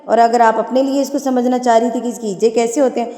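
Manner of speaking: ordinary delivery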